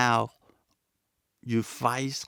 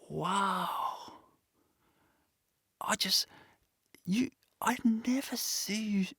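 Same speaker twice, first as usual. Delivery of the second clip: high, airy half-whisper of wonder; awed admiration; 10 semitones higher, 6 dB quieter than usual. Acted. Other people marvel at your positivity, in hushed high awe. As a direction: imitate an amazed admirer, hushed and high-pitched. Voicing admirers looking at you.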